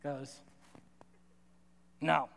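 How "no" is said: mock curt refusal; lightly voiced cranky lady shutting it down; a short, deadpan imitation of a refusal; cranky lunch lady.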